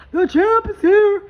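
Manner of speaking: slow, high and loud ring-announcer boom; hype champ call. Pretend. Reading cards and hyping the viewer's win with a loud champ call. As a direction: announce the champion to the whole arena.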